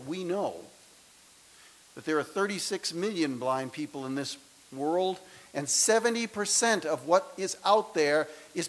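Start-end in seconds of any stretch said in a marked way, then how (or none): none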